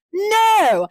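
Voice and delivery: loud, high, drawn-out no; emphatic denial. Real, not acted. Podcaster denying a Chinese accent, loud protest. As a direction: cry out in protest.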